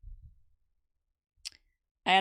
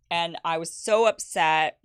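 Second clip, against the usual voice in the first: ordinary delivery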